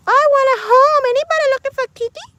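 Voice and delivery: high, loud, fast kitten plea. Retold or imitated. Voicing a kitten asking viewers for a home, high and loud. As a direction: squeak it like a kitten begging.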